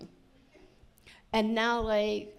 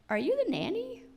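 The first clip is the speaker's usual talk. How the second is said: mock judgy stranger; 10 semitones higher than usual. Strangers judging her with kids.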